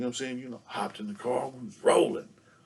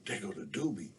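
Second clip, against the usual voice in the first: hushed whisper to himself; whispered thought; 6 dB quieter than usual. Retold or imitated. Kid alone in back seat, whispering. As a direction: whisper it so nobody hears. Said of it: "and I'm like".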